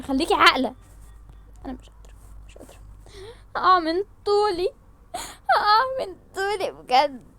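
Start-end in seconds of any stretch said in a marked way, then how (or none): 1.6-2.8 whispered, fading; faint whisper. Acted. 3.6-7.3 slow, higher, teary; about to collapse; faint wailing. Acted.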